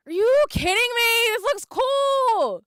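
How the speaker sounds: high, loud excited squeal; loud fan excitement; thrilled outburst. Real, not acted. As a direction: gush at top pitch, drawing out the end. Gushes over the new cards.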